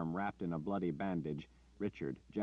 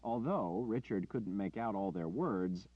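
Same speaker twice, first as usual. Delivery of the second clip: ordinary delivery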